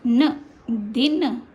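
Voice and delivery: slow careful दिन with short i; short vowel in दिन. Shown as a demonstration. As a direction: keep दिन short and crisp. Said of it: "जब छोटे की मात्रा है तो दि".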